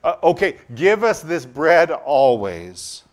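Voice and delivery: loud, slow, eager plea; eager crowd. Retold or imitated. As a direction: stretch out the plea like a hungry crowd.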